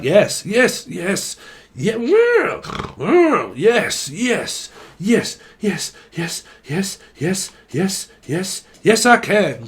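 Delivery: high, loud, eager chant of yes; excited yes. Pretend. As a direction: gush yes after yes, like a fan. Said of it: "Some of you are like".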